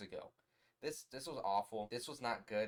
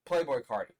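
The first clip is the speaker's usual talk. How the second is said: loud announcement; 3 semitones higher, 9 dB louder than usual. Acted. Announcing the big feature.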